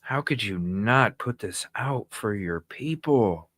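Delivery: ordinary talk, nothing marked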